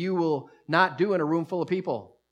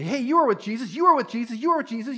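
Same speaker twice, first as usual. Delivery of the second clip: accusing; 7 semitones higher, 4 dB louder than usual. Retold or imitated. Bystanders accuse Peter.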